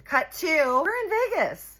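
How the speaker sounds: very high, loud whoop of words; excited reveal. Real, not acted. Storyteller revealing the sudden jump to Vegas, excited shout. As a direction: shout the punchline out.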